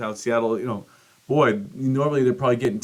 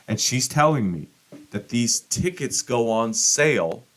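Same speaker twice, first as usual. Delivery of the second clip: ordinary talk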